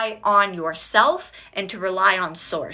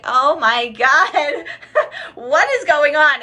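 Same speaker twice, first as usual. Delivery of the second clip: laughing disbelief; 6 semitones higher, 5 dB louder, slower than usual. Retold or imitated. Retelling own panicked reaction.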